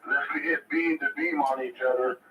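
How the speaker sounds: usual speaking voice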